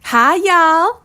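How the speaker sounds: high, loud Southern belle; campy drag queen. Acted. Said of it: "Marguerite Daisy Crimson".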